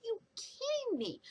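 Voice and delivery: high, soft incredulous gasp. Retold or imitated. Reviewer reacting to father abandoning the boy, high incredulous hush. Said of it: "And I'm like".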